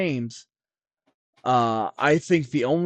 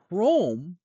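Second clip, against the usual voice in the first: Chrome set apart, drawn out and higher; stress on Chrome; 6 semitones higher than usual. Real, not acted. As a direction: lean hard on the word Chrome. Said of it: "I emphasize the word chrome".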